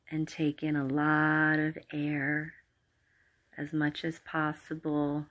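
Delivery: calm, unhurried meditation voice with long pauses; guided meditation. Acted. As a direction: guide the listener gently into relaxation. Leads a guided relaxation meditation.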